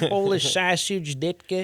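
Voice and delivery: slow, hammed-up Chicago Bears fan; drawn-out "Polish sausage" like an 85 Bears superfan; mock Chicago Bears fan; Chicago accent. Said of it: "the Chicago accent to me".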